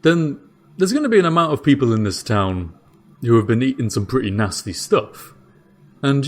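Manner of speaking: bewildered jailer, thinking it through aloud; Dave the jailer. Acted. Dave explains his theory.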